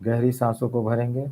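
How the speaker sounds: slow, drawn-out breathing cues with long pauses; guided breathing meditation. Real, not acted. Leads deep breathing meditation.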